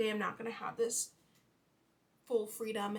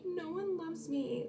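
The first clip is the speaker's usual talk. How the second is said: high, slow mock wail of self-pity; 7 semitones higher, slower than usual. On her period, mocking her own self-pity. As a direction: whine it like a sulking teen. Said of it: "I get so emotional. And I'm like".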